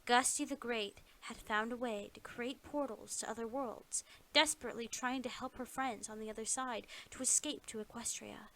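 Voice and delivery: plain reading voice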